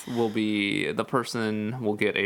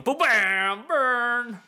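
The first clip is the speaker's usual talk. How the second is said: gloating; 11 semitones higher, 3 dB louder, much slower than usual. Real, not acted. Gloating after a comeback.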